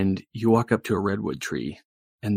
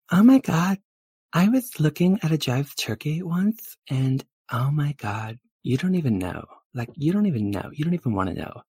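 higher girly storytelling, lightly put on; gushing valley girl, dramatic; 6 semitones higher, 4 dB louder than usual. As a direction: lightly play a breathless gossiping girlfriend.

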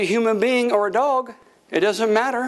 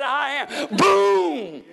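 high proclamation with a drawn-out boom; preaching God's word; 5 semitones higher, much slower than usual. Acted. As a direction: proclaim it high, then let the boom fall. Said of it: "He just said".

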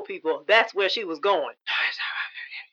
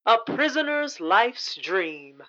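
normal talking voice

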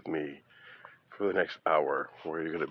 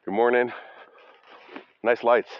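higher, louder friendly greeting; calling out; 4 semitones higher, 8 dB louder than usual. Real, not acted. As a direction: call out cheerily to a passer-by. Greeting passerbys outdoors.

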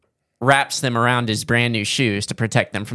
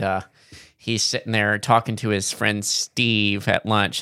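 usual speaking voice

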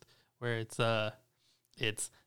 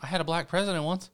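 higher, louder mocking voice; 6 semitones higher, 5 dB louder than usual. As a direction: parody a defensive relative. Mocking the family's excuse.

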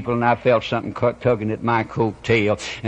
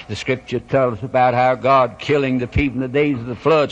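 usual speaking voice

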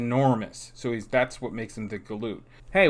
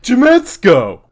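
very loud bellow of the name; 9 semitones higher, 14 dB louder, slower than usual. Acted. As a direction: belt it back at full volume. Guest yells the name back.

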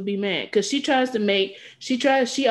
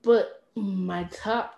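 normal talking voice

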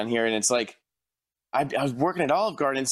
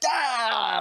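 pained groan; 8 semitones higher than usual. Retold or imitated. Customer's order hurts.

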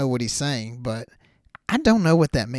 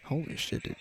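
awed; 2 semitones higher, 9 dB quieter than usual. Real, not acted. Amazed at the fight clip.

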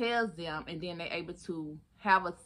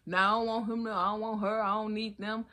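higher, insistent mock denial; mock stubborn refusal; 4 semitones higher, 4 dB louder than usual. Vlogger mocks viewers who refuse support, mock stubborn denial. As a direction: play someone stubbornly pushing help away. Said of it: "Don't be".

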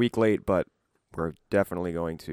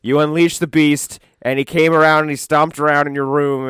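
loud; 9 semitones higher, 11 dB louder than usual. Acted. Mock-boasting about drunk night.

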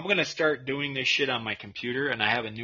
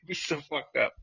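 ordinary talk, nothing marked

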